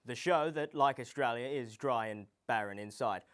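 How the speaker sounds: usual speaking voice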